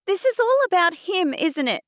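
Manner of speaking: very high, loud accusation; angry demand. A narrator's reading. Girl cutting in on Mum, demanding angrily. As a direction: demand it, boiling over. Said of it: "I demanded".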